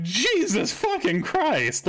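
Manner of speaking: high, slow, stretched out shock; shocked outburst. Retold or imitated. Reacting on the phone to the crying client's news. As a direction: drag it out in horror. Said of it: "I was like".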